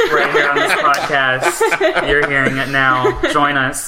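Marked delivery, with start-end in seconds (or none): 0.0-2.1 loud laughing announcement. Real, not acted.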